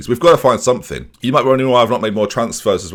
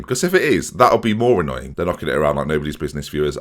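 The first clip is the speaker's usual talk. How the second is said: usual speaking voice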